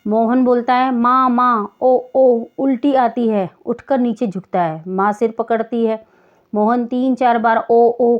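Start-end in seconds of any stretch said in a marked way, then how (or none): 0.9-3.5 a mild retching groan calling for his mother; groaning in pain. Acted. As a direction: moan out for mother, then gag. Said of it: "Mohan ज़ोर से कराहता है". (माँ को पुकारते हुए हल्की कराह और उबकाई)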